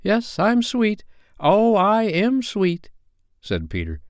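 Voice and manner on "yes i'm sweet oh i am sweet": put-on high, slow, self-admiring boy voice; cocky child crowing about himself; boastful boy. A narrator's reading. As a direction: draw it out high, showing off. Peter shows off, forgetting his manners.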